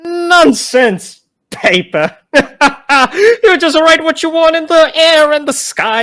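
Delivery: high, loud laughing scoff; mock the idea with a laugh; scoffing lich.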